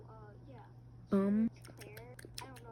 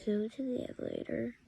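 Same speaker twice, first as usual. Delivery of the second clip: soft whisper; 5 dB quieter than usual. Real, not acted. Whispered game line.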